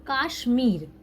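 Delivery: usual speaking voice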